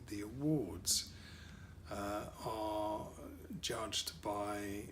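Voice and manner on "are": ordinary delivery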